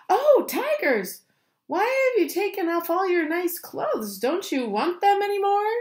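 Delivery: high-pitched call to the tigers. A narrator's reading. Rajani calls to the tigers from afar, high calling. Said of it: "jumped up and called out".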